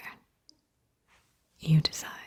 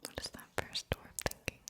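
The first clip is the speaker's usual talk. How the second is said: very faint, soft whisper; barely audible whispered question; whispered echo; 12 dB quieter, faster than usual. Acted.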